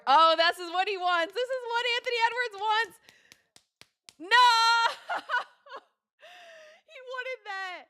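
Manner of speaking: very high squealing disbelief; excited disbelief. Real, not acted. Fan squealing as rival star hits a three, excited. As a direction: squeal as the rival gets what he wants.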